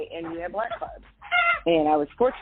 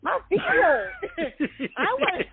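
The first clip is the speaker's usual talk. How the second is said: laughing complaint; 12 semitones higher than usual. Retold or imitated. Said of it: "I'd be like".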